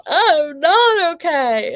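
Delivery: loud, drawn-out high wail in imitation; protesting child. Hurt child correcting her parent, wailed protest. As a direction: wail it back, stretched and indignant.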